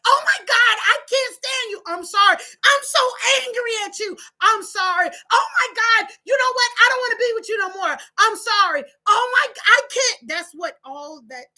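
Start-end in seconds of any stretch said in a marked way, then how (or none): 0.1-10.3 high, loud, mock-frantic outburst; frantic back-and-forth. Couple's fight acted out, high and loud mock frenzy. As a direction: swing between apology and rage. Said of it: "you just keep saying".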